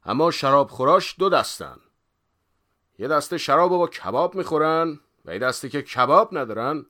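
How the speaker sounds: loud, high schoolmaster declaiming; mock lecturer. A narrator's reading. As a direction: lay out the lesson emphatically. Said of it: "بغوث ادامه داد".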